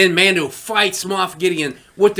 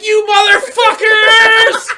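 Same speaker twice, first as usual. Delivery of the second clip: much higher, louder, slow drawn out; triumphant yell; 8 dB louder, much slower than usual. Retold or imitated. Cheering Luke on against the troopers, a slow triumphant yell. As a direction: roar it in glee. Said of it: "And I'm like".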